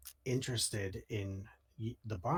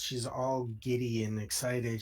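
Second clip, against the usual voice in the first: ordinary delivery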